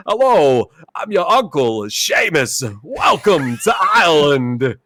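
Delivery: a lightly voiced thick Brooklyn accent playing an Irish uncle; mock Christopher Walken, Brooklyn accent, no Irish at all. Friend retelling Walken's line in a mild Brooklyn accent. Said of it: "the thickest Brooklyn accent you could ever wanna hear".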